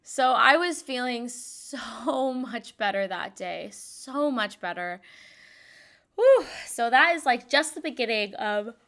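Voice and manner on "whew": loud exhale. Real, not acted. Yawn spirit moment.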